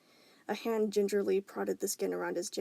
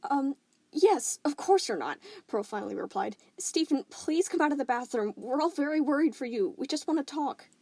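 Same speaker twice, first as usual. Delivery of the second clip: lightly voiced Pearl; 6 semitones higher, 5 dB louder than usual.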